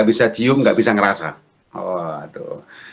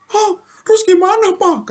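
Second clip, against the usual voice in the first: very high, louder alarmed squeal; panicked wife; 6 dB louder than usual. Retold or imitated. Wife hears covid has no medicine, panicked high cry. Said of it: "kata istri saya".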